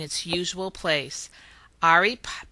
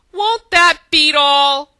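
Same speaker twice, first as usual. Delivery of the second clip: slow, much louder, higher gasp of glee; gasping delight; 8 semitones higher, 11 dB louder, slower than usual. A narrator's reading. Leprechaun gasping at the prank's payoff. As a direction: gasp it out in wonder. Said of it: "Call gasped".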